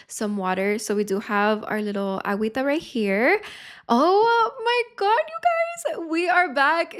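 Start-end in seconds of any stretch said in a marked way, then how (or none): 3.9-7.0 high, thrilled squeal of a welcome; excited greeting. Real, not acted. Host thrilled to be back with her listeners, high excited squeal. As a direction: burst in overjoyed and much higher.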